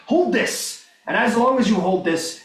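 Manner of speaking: lightly voiced knightly command; commanding crusader. Solemn handing over of insignia.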